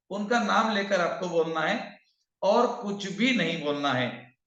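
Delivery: ordinary talk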